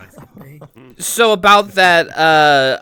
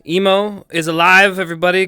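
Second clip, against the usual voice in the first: ordinary talk, nothing marked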